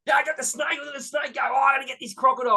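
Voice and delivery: high, excited, shouted wildlife-show impersonation; Steve Irwin. Acted. Host shows Americans his snake and crocodile act, excited Aussie impersonation. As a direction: burst out like an animal wrangler mid-catch. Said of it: "show what Steve Irwin was like".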